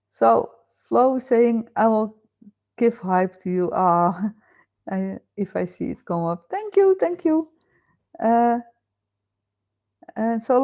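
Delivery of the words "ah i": usual speaking voice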